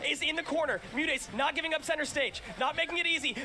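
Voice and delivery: high, excited play-by-play call; esports caster. Real, not acted. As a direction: call the scramble like a hyped caster. Live hype play-by-play.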